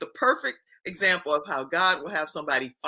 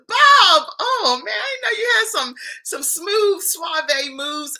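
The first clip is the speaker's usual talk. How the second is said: excited; 11 semitones higher, 4 dB louder, slower than usual. Real, not acted. Host reacts excitedly to story.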